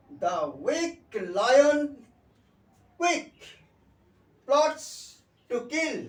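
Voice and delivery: slow, loud, word-by-word tongue twister; tongue twister demo. Shown as a demonstration. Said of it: "আমরা একটা tongue twister দিয়ে দিচ্ছি".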